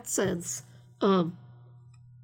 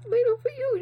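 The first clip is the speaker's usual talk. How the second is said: playful retort; 3 dB louder than usual. Retold or imitated. Retorting to guys mocking her.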